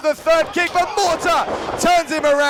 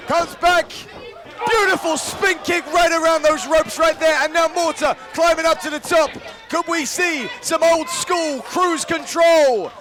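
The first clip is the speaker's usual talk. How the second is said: wrestling commentator; 5 semitones higher than usual. Acted. Live play-by-play call.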